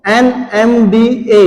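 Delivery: "NMDA" spelled out slowly, each letter separate. Real, not acted. Answers own question, letter by letter. ("NMDA" एक-एक अक्षर अलग करके, धीरे)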